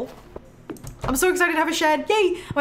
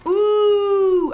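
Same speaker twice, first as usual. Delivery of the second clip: loud, high delighted ooh; light up at the find; excited ooh; 7 semitones higher, 7 dB louder than usual. Real, not acted. She spots the love book in the shop, thrilled ooh.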